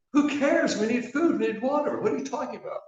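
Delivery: desperate, almost squeaking with panic; frantic nervous guy. Acted.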